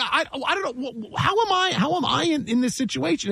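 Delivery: high, incredulous squeak of disbelief; can't believe this is happening to me; stunned disbelief. Retold or imitated. Host retelling being blindsided by his manager, in stunned high-pitched disbelief.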